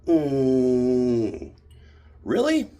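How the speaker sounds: a scornful, disbelieving little jab; bitchy look. Retold or imitated. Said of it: "gives me that real bitchy look like".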